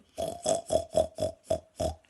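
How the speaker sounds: very low snorting pig noise; pig grunt. Retold or imitated. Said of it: "He's going".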